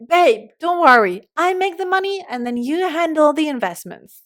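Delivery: breezy reassurance to partner. Retold or imitated. Acting out line to partner.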